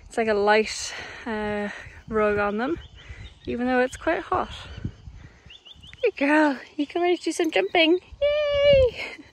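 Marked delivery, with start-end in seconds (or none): 6.0-9.2 talking to a horse. Real, not acted. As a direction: gush over the horse in a high sing-song. Praising the horse before jumping.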